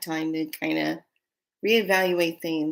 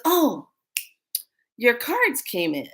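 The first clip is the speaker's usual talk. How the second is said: higher, bright announcement; imitating the owner; 6 semitones higher than usual. Owner tells her about the cards, higher voice. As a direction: do the owner remembering, high. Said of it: "she goes".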